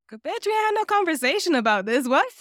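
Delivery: incredulous Logan. Retold or imitated. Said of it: "he's kinda like".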